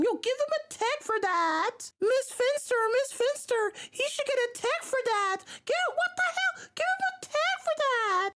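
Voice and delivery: high, whiny tattletale voice; Randall snitch voice. Retold or imitated. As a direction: squeal it like a snitching kid. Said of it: "Embiid basically being Randall from Recess, tries snitching".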